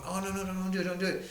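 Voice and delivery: quick string of anxious no's, lightly acted; imitated pleading girlfriend. Man retells girlfriend holding him back, mock pleading. Voices girlfriend stopping the fight.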